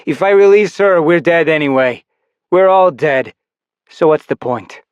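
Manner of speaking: despairing. Acted. Rebel's despairing argument.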